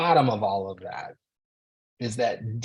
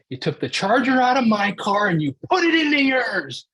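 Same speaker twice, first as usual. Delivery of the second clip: much higher, louder retold scream of blame; screaming at wife; 13 semitones higher, 8 dB louder than usual. Husband re-enacting screaming at his wife through the window, high and loud. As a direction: yell the accusation through the car window. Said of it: "I scream at her through the half open window".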